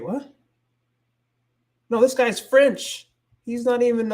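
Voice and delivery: sudden high, startled realisation; surprised. Real, not acted. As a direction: catch yourself mid-search, surprised. Realises the video is French.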